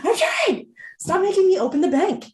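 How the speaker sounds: very high, squeaky comic protest; mock exasperated retort. Employee answering boss's "be on time", mock exasperated squeal. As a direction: whine back in mock desperation.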